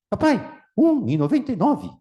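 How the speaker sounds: put-on high, eager little kid voice; child voice. Boy tells father the price, high eager kid. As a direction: chirp the price like an excited child.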